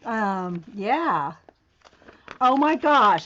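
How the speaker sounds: ordinary talk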